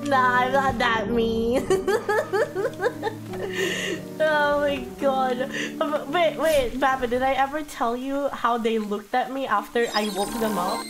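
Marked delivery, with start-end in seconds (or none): none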